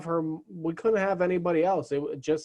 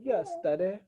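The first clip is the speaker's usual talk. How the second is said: soft mock British baby voice; British accent; 6 dB quieter than usual. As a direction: play the baby as lightly British. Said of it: "she got a more British accent".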